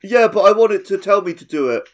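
high, loud whining fan; whiny fan. Retold or imitated. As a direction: whine it like a spoiled fan. Imagined fan whining back.

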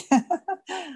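a quick high burst of laughter; bright amused chuckle; short real laugh. Real, not acted. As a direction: laugh lightly at the joke. Laughs after joking answer.

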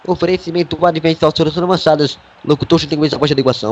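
ordinary talk

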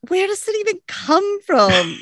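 mock bewilderment at the line; retelling her own confusion; baffled thought. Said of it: "I'm just like".

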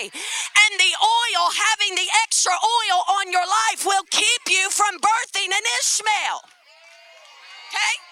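loud, slow, crying preacher build; full-force preaching, slow and loud. Acted. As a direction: cry it out to the congregation.

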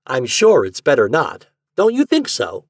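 eager question, leaping high at the end. A narrator's reading. Garvey waiting on the secretary's answer, eager and high. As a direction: press eagerly for agreement, pitch climbing. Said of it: "appeared to wait eagerly for the answer".

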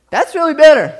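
high, loud disgusted yelp; bitter shock. Retold or imitated. Dad and kids tasting cranberries, loud disgusted yelp. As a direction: spit it out like the taste shocked you.

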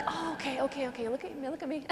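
high, soft hushing reassurance; calming a child. Retold or imitated. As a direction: gently get a crying child's attention. Teacher calming a sobbing boy.

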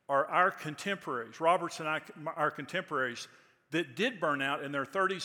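loud, rising preacher cadence; preaching at full force. Acted. Preacher warning young ministers about burnout, loud full-force preaching. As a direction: build it like a sermon climax.

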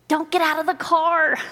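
high, exasperated mock warning; mock scream. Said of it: "You're screaming at the T V".

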